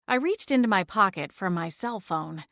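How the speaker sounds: ordinary delivery, nothing marked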